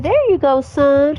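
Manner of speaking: high mom voice. Acted. Mom sees son off to school, high cheerful voice.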